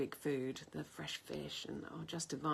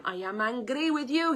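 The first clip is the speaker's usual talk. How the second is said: mimicked loud insistence; 7 semitones higher, 10 dB louder than usual. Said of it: "he said".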